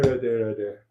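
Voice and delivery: ordinary talk, nothing marked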